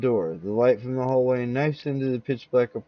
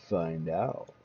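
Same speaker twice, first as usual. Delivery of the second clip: ominous host tease; 7 semitones lower, 7 dB quieter than usual. Acted.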